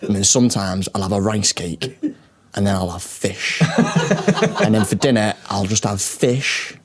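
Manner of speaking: deadpan parody of his diet; imitating video guy. Mimicking gym video guy.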